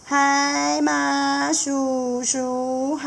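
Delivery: very slow, high sing-song; baby catfish greeting Uncle Seahorse, slow sing-song; chorus of polite babies. Acted. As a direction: chant it in unison like little kids. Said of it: "就说".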